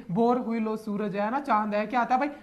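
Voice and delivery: ordinary talk, nothing marked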